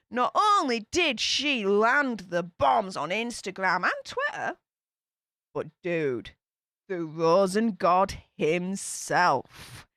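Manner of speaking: ordinary talk, nothing marked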